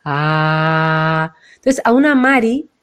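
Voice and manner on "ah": low held "Ah" from the chest; heart-centred ah. Shown as a demonstration. Coach demonstrating the heart "Ah", low and held. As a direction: sound a long low "Ah" with a hand on the heart. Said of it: "ponerse la mano y de sentir".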